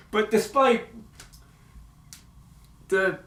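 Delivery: ordinary talk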